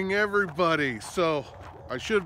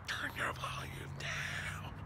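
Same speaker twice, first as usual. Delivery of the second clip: a whispered request to be quieter; whispered hush; 14 dB quieter, slower than usual. Retold or imitated. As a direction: hiss it softly like shushing someone. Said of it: "somebody goes like this".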